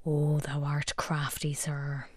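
hushed mock-Shakespearean aside; theatrical whispered aside; stage whisper. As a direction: whisper it like a sly conspirator. Host's mock-dramatic aside.

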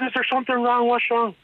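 put-on high anxious older woman asking; worried mother. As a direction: fret like a startled mother. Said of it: "She said".

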